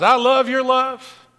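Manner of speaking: "your love" lifted high and loud; stress on your love. Shown as a demonstration. Preacher emphasizing the line from his notes, high and loud. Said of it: "'cause I wanted to emphasize".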